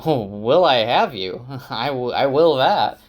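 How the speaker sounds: delighted. Acted. Perrin delighted, laughing.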